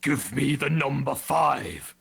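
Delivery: slow, drawn-out villain voice; Garmadon. Acted. Podcaster reads a fan's requested quote as a drive-through order, slow villain voice. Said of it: "read it in my Garmadon, uh, voice".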